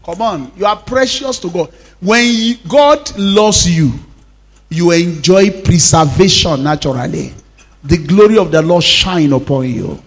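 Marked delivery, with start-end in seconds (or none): none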